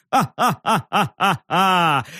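slow, wild madman's cackle; mad laughter. A narrator's reading. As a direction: cackle madly at the sky. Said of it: "он безумно захохотал".

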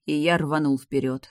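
ordinary delivery, nothing marked

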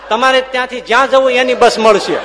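loud, high, laughing delivery; laughing punchline. Real, not acted. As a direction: burst out with the joke's payoff. Punchline of the latrine joke. (હસતાં હસતાં જોરથી વાર્તાનો અંત)

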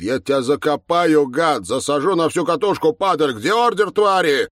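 loud, high-pitched tirade; furious shouting. A narrator's reading. Mikhail yelling at officers pushing him to the floor, furious. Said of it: "Михаил кричит".

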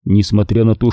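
ordinary delivery

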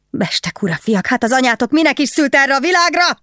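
high screeching abuse, rising sharply; angry screech. A narrator's reading. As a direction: screech furiously at the guards, climbing in pitch. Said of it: "rikácsolt rájuk a másik nő".

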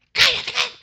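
high, loud scolding shout; angry hushing. Acted. Crowd trying to silence the blind man, high angry shout. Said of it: "lo mandaban a callar".

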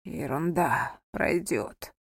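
soft, low brushing-off; strained reassurance. A narrator's reading. Wounded husband reassures his wife, strained smile. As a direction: reassure through a forced smile. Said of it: "улыбнулся Анхель, хотя эта улыбка получилась вымученной".

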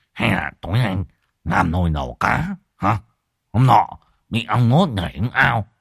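mumbled through fat split lips; swollen-mouthed man. A narrator's reading. Stung friend suggests cooking fish, mumbling through swollen lips. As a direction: talk as if the lips are badly swollen. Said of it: "mõm sưng môi chẻ ra trông như cái mỏ vịt".

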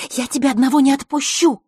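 high, loud insistent hiss. A narrator's reading. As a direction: hiss it as a heated refusal. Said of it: "прошипел знакомый голос". (рассерженно шипя, высоким голосом)

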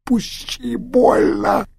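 very high, slow, pained crying; delirious dying man. Acted. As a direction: cry out in delirium.

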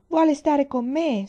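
high, loud and slow plea; a child begging to keep the baby; pleading. A narrator's reading. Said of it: "supplicò". (supplica lenta, acuta e forte)

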